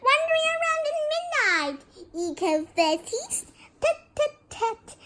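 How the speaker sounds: very high, gleeful taunt; mischievous high-pitched scolding; cackling poltergeist. A narrator's reading. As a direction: tease in a squeaky imp voice. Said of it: "Peeves cackled".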